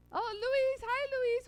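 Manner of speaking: delighted high greeting. Real, not acted. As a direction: greet a dear friend with delight.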